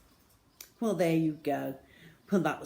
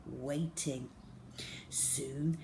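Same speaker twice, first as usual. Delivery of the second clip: ordinary delivery, nothing marked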